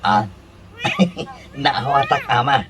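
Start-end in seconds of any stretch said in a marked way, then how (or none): 0.8-1.3 a sudden high, breathless giggle. Acted. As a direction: giggle up high through the nose. Caught out, bursts laughing. (agkatkatawa a nangato)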